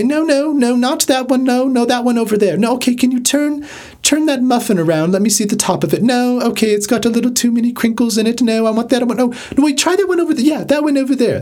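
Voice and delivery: very high, fretful fusspot; persnickety diner. Acted. Picky guest redirecting the chef at the buffet, high persnickety voice. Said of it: "become more persnickety and say".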